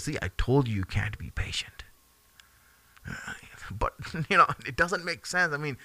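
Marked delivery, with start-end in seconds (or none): 0.0-1.8 soft, low whisper of told-you-so; whispered rebuke. Retold or imitated. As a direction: hush it like a knowing teacher. Khidr's calm rebuke to Musa.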